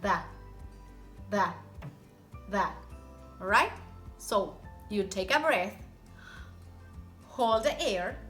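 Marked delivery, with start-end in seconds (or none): 0.0-2.7 "Da" with one quick tongue tap on the palate; tongue tap demo. Shown as a demonstration. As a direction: tap "Da" lightly, tip of tongue. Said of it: "you tap with the tip of your tongue".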